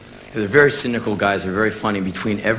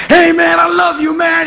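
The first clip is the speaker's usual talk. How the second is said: much higher, loud shouting hype-man; 8 dB louder than usual. Retold or imitated. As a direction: bellow it like an overjoyed hype man. [name] greets him.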